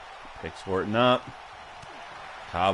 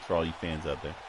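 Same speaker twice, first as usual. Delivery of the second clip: normal talking voice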